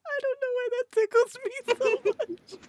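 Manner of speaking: words squeezed out through giggles; helpless laughter. Real, not acted. To the friend who called them losers, cracking up.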